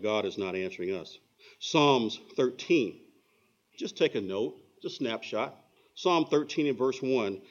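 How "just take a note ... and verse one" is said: normal talking voice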